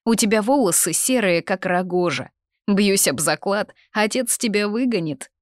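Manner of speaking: taunting. A narrator's reading. Sisters trading insults.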